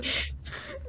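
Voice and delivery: high breathy giggle. Real, not acted. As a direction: burst into a giggle. Laughing at retort.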